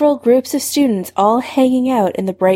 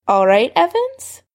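higher, pleasant greeting; pleasantly casual, pitched up; suddenly pleasant; 6 semitones higher than usual. A narrator's reading. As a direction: turn on a pleasant, lifted tone. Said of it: "his voice was suddenly pleasant".

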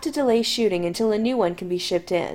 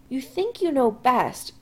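solemnly; 5 semitones higher, slower than usual. Acted. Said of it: "Chris informs him solemnly".

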